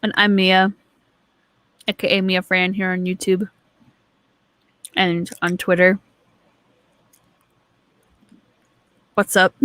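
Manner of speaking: talking around ice in mouth. Real, not acted. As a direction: speak with ice in your mouth. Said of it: "I have ice in my mouth".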